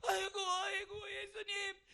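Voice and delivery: loud, high, drawn-out wail of grief; weeping cry. Acted. Martha seeing Jesus arrive, wailing tearfully. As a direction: burst into acted sobbing lament, calling out. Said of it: "울면서 말합니다".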